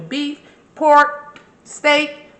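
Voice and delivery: pork and steak called out high and loud; excited list. Real, not acted. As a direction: rattle off the meats, thrilled. Listing meats with enthusiasm.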